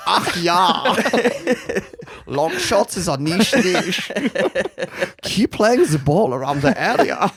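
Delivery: loud, slow, hammed-up German accent; mock Eurogay accent. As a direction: exaggerate a camp German football manager. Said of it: "the Euro game was, was creeping into".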